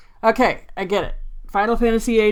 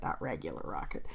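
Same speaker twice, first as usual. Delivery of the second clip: much lower, softer throwaway; quiet aside; 14 dB quieter than usual. Real, not acted. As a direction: drop it low and soft, as an aside. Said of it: "which I did capitalize".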